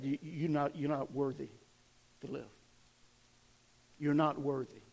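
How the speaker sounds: low, soft, dragging the words down; accusing enemy voice. Retold or imitated. The enemy tells Judas he is not worthy, low and soft.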